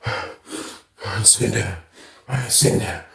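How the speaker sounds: imitating a stubborn friend's low grumble; stubborn refusal. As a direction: growl it under your breath, won't budge.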